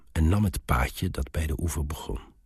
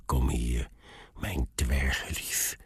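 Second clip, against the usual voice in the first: panting dwarf; 2 semitones lower, 5 dB quieter, slower than usual. A narrator's reading. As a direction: pant it out slowly, half whispered. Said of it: "hijgde hij". (hijgend, zacht en laag)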